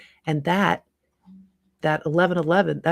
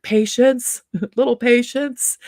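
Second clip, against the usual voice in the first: loud, high call for patience; yelling back; 7 semitones higher, 7 dB louder, slower than usual. Retold or imitated. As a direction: shout it at the rude driver. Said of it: "I yelled back".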